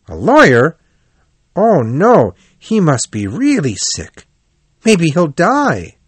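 high, dismayed worry; alarmed. A narrator's reading. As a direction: voice jumps up in shock at a friend's illness. Rat alarmed at lawyer request.

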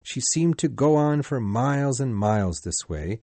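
ordinary delivery

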